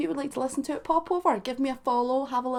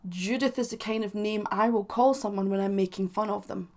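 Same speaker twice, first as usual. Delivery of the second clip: ordinary talk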